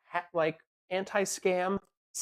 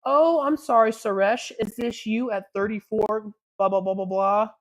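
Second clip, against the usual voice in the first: mocking taunt; 4 semitones higher, 7 dB louder than usual. Taunting a caught scammer.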